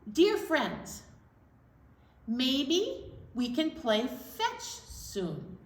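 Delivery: ordinary delivery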